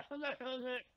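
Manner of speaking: high-pitched mock Indian operator; Indian accent. Co-host answering a customer service call, Indian accent. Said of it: "you put on an Indian accent".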